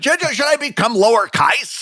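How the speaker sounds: high-pitched, hammed-up British RP; mock British accent. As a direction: play a silly Brit, amused. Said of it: "you became British there for a minute".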